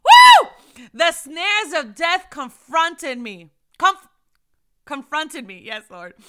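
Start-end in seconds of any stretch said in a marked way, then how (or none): 0.0-0.5 loud joyful woo; high whoop of praise. Real, not acted. Preacher whooping in praise. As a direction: let out a whoop.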